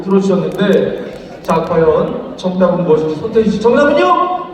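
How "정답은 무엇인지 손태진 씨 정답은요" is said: game show host. Acted. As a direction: build suspense for the reveal. Host asks for answer.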